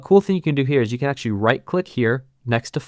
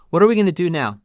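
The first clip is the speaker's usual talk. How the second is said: ordinary talk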